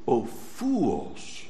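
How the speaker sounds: a shepherd's quiet, loving rebuke; tender rebuke. Acted. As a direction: gently chide the sheep. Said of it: "He calls them".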